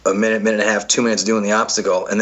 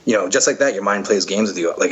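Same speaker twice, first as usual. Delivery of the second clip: normal talking voice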